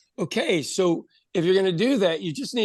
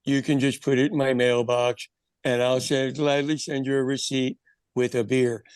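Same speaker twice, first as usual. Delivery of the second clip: deadpan; 5 semitones lower than usual. Retold or imitated. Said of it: "a deadpan bit".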